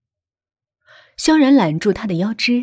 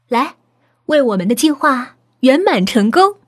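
raised, eager toast with a laugh; excited toast; 5 semitones higher, 4 dB louder than usual. Acted. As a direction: toast the success gleefully. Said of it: "高明兴奋地举起酒杯". (兴奋地举杯祝酒)

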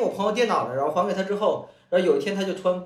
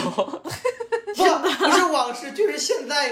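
laughing; 7 semitones higher, much slower than usual. Real, not acted. Laughing while insisting.

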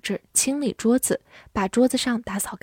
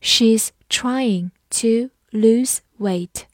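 slow reading; much slower than usual. Shown as a demonstration. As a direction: read it slowly so they can follow. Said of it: "跟着我慢读一遍".

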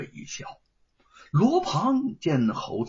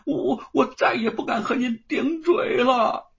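high, sobbing plea for mercy; a terrified farmhand wailing for mercy; wailing loudly; 9 semitones higher than usual. A narrator's reading. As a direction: cry out begging, voice breaking. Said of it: "大声地哭嚎着".

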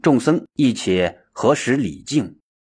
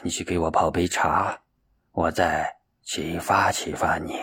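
put-on old master, grave; 5 semitones lower, 5 dB quieter than usual. A narrator's reading. Master telling disciple he has not awakened, grave. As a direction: counsel gently and deliberately, pitched well down.

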